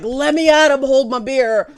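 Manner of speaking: loud, pumped-up call to fight; eager mock bravado. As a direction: act like a guy rolling up his sleeves for a brawl. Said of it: "I'm just like".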